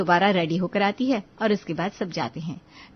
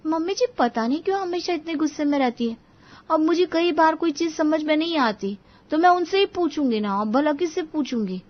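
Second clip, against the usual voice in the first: put-on higher-pitched young daughter-in-law talking to herself; puzzled musing to herself, pitched up; खुद से बात करती बहू; 8 semitones higher than usual. A narrator's reading. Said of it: "Anjali खुद से बातें करते हुए". (अपने आप से उलझन में बात करती बहू, ऊँचे सुर में)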